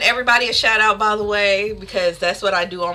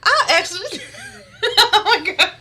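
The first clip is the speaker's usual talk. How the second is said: high, helpless real laughter through the words; laughing; 13 semitones higher than usual. Real, not acted. Streamer cracks up at her chat, laughing hard. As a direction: crack up mid-sentence, pitch way up.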